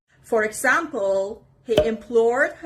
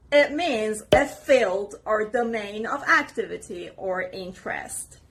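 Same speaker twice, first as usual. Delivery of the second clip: usual speaking voice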